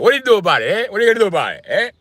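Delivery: high, loud imitation of Italian guys; hammed-up Italian-American challenge; Italian accent. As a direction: hammer out a cocky Italian taunt. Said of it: "Italian guys love saying that".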